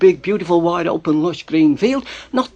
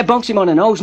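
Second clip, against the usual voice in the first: ordinary delivery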